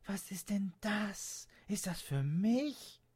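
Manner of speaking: put-on high, slow, wondering girl's voice; a little girl amazed at her new bike; astonished child voice; young girl Leonie.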